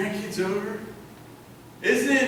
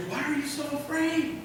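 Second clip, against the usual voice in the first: higher, softer incredulous question; questioning Jesus; 6 semitones higher, 6 dB quieter than usual. Acted. Jesus to his disciples after the calm, softly questioning. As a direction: ask it softly, pitched up. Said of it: "he said to his disciples".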